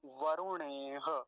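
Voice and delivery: वरुणेह slowly, ending on an audible ह; clear final ह. Shown as a demonstration. Teacher stresses the final हकार for students, slowly. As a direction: finish वरुणेह firmly on the ह. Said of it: "ह पर लाकर अच्छे से समाप्त करेंगे".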